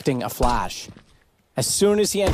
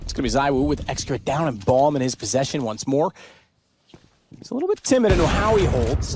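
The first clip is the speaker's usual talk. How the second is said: ordinary delivery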